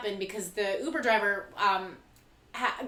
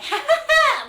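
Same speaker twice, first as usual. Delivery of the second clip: much higher, louder fake ha-ha; mock jovial laugh; 10 dB louder than usual. Imitating the Uber pool guy, hammed-up laugh much higher and louder. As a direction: burst into an overly jovial laugh.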